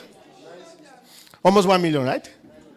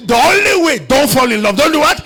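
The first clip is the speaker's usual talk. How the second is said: high, loud, urgent warning from the pulpit; full-force preaching; 12 semitones higher, 11 dB louder than usual. Real, not acted. Preacher warns congregation about falling in love, shouted. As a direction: preach it at a raised, driving pitch.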